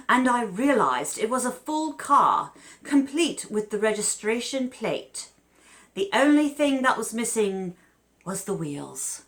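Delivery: light put-on British accent. Host quoting the English homeowner in a British accent. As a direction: read the homeowner's words as a Brit. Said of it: "he's British".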